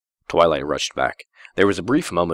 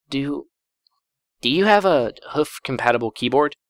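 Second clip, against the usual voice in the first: sheepish high question; 8 semitones higher than usual. Acted. Embarrassed Twilight asks sheepishly.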